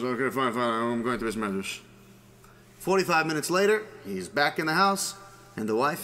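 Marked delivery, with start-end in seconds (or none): 0.0-1.8 low, resigned compliance; grudging husband. Acted. Husband gives in to his wife, mildly grudging. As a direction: give in grudgingly, pitched down. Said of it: "husband doesn't feel too good about himself".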